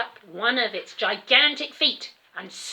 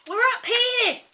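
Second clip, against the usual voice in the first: much higher and louder call; 8 dB louder than usual. A narrator's reading. Calling down to the chauffeur below, loud. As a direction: call down from the treehouse. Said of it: "I called down to him".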